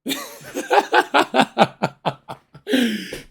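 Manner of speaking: high, loud cackling laugh; real laughter at the story; loud burst of laughter. Real, not acted. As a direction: crack up at the punchline. Laughing at own punchline.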